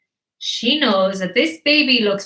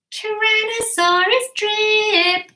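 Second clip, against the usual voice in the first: high, slow playground chant; singsong taunt; 12 semitones higher, slower than usual. Acted. Sisters name their odd little brother, taunting singsong. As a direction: tease him in a drawn-out high singsong.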